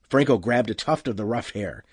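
ordinary delivery, nothing marked

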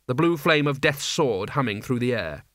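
ordinary delivery, nothing marked

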